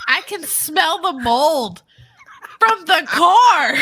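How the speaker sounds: slow, high exclamation building to a shout; hammed-up outrage. Hammed-up retelling of her disgust.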